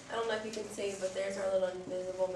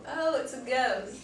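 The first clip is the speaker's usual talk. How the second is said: mock scared; 4 semitones higher, 8 dB louder, slower than usual. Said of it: "this person right here says".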